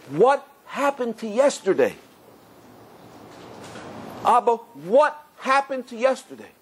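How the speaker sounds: imitated troubled, drawn-out questioning; bothered child's question. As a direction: draw it out like a puzzled child asking father. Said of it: "like it's really bothering him".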